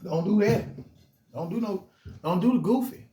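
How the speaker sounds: high, loud calling out to stop; urgent warning. Retold or imitated. Big homie yelling at youngsters to stop, loud and high. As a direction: shout them down before it starts.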